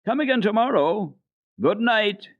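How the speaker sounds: called out high and loud; boy calling goodbye across the meadow. Acted. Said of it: "Peter called out to Heidi".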